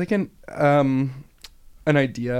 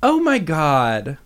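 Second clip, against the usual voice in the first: higher, very slow drawn-out exclamation; Parker Posey impression; 5 semitones higher, 3 dB louder, much slower than usual. Eagerly sharing an unfinished impression with co-host, drawn-out actress voice. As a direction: stretch it out like a half-formed actress impression. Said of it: "my Parker Posey one".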